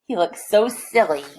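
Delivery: ordinary delivery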